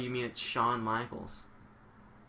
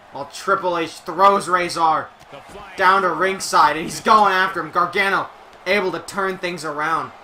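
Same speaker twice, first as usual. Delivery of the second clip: louder, punchy ringside commentary; play-by-play call; 4 semitones higher, 9 dB louder than usual. Acted. Streamer calling the brawl for viewers, lively play-by-play. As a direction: call the action like a wrestling announcer.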